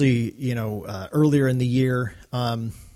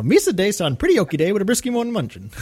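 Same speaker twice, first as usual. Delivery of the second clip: higher and goofy, bouncy alien chatter; Jar Jar impression; 7 semitones higher than usual. Podcast host mimicking Jar Jar launching into his story, high and goofy. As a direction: rattle it off like the clumsy Gungan. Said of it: "Jar Jar launches into his story".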